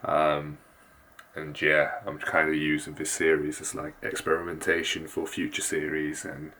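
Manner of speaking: hushed so sleepers stay asleep; soft, low late-night voice; keeping voice low. Real, not acted. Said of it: "I'm gonna have to keep my voice very low".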